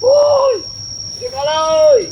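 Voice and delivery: high shout into the dark; calling out. Acted. Thug calls out into the dark for Jakabaya, shouting. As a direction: call out for Jakabaya.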